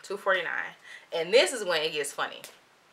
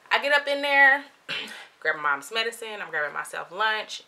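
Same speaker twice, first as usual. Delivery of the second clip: ordinary talk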